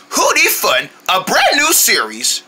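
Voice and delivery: normal talking voice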